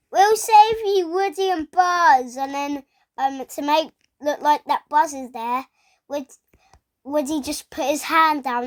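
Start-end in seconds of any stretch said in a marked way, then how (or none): 0.1-2.3 high, loud rescuing call; toys calling out. Retold or imitated. Retelling Andy's toys promising a rescue, high loud call. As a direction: call out to friends in trouble. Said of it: "And then they go".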